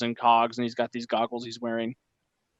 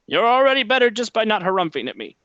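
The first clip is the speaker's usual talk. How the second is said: high, loud gnome merchant; 9 semitones higher, 5 dB louder than usual. Acted. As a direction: chirp it as the gnome. Gnome NPC reply.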